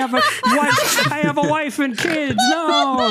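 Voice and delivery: high, hammed-up begging; mock panicked plea. GM as the security guard begging the dogs, high mock panic. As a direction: beg for your life, overplayed. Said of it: "security guard going".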